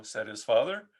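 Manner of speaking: ordinary delivery, nothing marked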